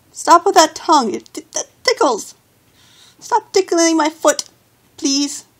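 being tickled. Real, not acted. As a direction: squirm away from a licking tongue.

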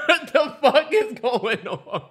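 high, slow words broken by laughter; laughing disbelief. Real, not acted. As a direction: laugh through the question in disbelief. Laughing at the oyster clip.

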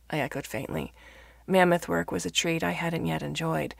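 plain reading voice

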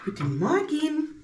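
high, slow, drawn-out good morning; sing-song greeting. Real, not acted.

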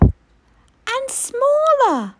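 high, slow, stretched-out tiny voice; shrinking voice. A narrator's reading. Reading the shrinking mammoth, high and drawn out. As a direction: squeeze the word up small and slow.

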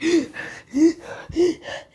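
high strangled gasping; choking gasps. Acted. Orochimaru choked by Naruto, strangled gasps. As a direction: gasp as if being choked. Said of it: "That's why I made that sound".

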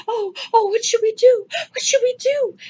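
high, whimpering mock panic. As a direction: whine it like a helpless, panicking crowd. Mocking helpless people's panic.

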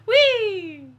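ordinary talk

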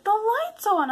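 put-on high childish announcement; little girl's voice. Goddaughter reporting the light to her aunt, high and childish. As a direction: say it like a six-year-old. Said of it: "She's like".

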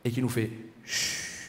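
soft and slow, a tender shush; hushing. A narrator's reading. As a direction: angel hushing the newborn. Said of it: "un ange qui vient sur le berceau".

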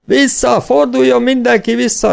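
high, loud cry of alarm; shouted warning. A narrator's reading. Wounded rider warning the gang of a trap, shouted. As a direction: yell for everyone to turn back.

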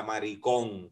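"maricón" with the accent on the O; stress on final O. Real, not acted. Co-host scolding a contestant in a competition, "maricón" stressed on the O. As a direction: lean on the last syllable of "maricón". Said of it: "con acento en la O".

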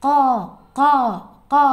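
ഖാ cawed from the back of the throat, uvular kh; crow caw. Shown as a demonstration. Teacher showing children the crow's ഖാ, throaty caw. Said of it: "ഈ അണ്ണാക്കിൽ ഇങ്ങനെ തട്ടിക്കൊണ്ട് വേണം പറയണം ഖാ".

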